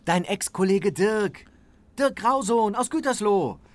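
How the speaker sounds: proud introduction. Acted.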